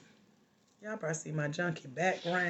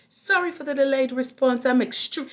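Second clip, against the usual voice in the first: louder, higher put-on apology; mock apology; 7 semitones higher, 8 dB louder than usual. Coach quoting an inbox excuse from clients, mock apology. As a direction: mimic a self-important excuse, pitched up.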